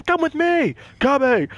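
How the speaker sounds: imitated high-pitched order-barking; mock yelled orders. Player mimics companion yelling orders, high mock yell. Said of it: "you just yell the orders".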